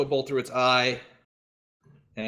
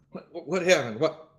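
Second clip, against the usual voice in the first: startled waking; 4 semitones higher, 4 dB louder than usual. Acted.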